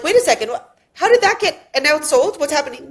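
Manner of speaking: mock confusion. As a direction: play the baffled onlookers, half-joking. Mock confused agents seeing listing.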